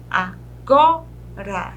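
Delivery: three separate beats in "agora", GO strongest; syllable by syllable. Shown as a demonstration. Teacher showing the penultimate syllable, "agora" broken up slowly. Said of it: "a penúltima".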